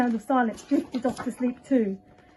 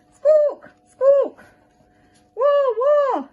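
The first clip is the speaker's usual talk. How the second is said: very high, loud squawks; squawking birds; 8 dB louder, much slower than usual. Acted. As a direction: screech like startled birds.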